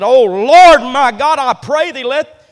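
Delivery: loud anguished prayer cry; crying out to God. Acted. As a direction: throw the prayer up to heaven. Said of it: "he looks to God and said".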